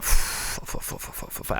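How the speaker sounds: rapid whispered swearing; panicked whisper. Retold or imitated. Stuck in the toilet queue, whispered panic. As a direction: whisper it, desperate and urgent.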